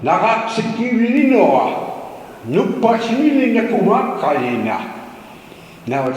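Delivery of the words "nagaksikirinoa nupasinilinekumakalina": confident mock Alaska Native speech, higher and louder; gibberish Alaska Native. Shown as a demonstration. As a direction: announce "Nagaksikirinoa" as if it were a real Native language. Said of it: "I'm doing gibberish".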